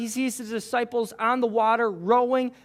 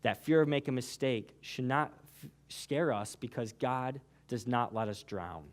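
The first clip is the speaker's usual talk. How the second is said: softer, lower, intimate; soft altar-call preaching; 10 semitones lower, 8 dB quieter than usual. Acted. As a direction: lower your voice and draw them in. Soft closing appeal.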